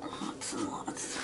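soft slow whispered echo. Pretend. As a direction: murmur it under the breath, slowly.

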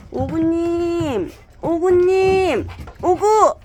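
long high drawn-out call; calling a dog. Real, not acted. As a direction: stretch the name, calling out.

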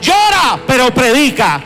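full-force pulpit shout; preaching shout. Real, not acted. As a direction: hammer each llora like a rallying cry. Preacher building to shouting climax.

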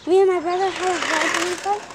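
ordinary talk

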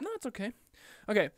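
ordinary talk

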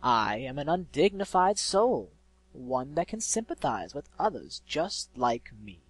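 loud, slow, theatrical self-introduction; grandiose eloquent villain. A narrator's reading. Unseen soul seeking griffin's sympathy, grandiose villain. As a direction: declaim slowly, swelling on the opening.

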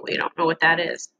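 ordinary talk, nothing marked

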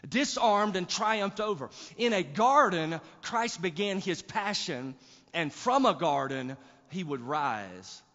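loud, fervent preaching. Acted. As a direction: proclaim it with full force. Preaching at full force.

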